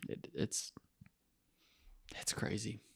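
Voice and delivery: barely voiced, breathy whisper. Real, not acted. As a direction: drop to a hushed whisper. Sad remark after grim story.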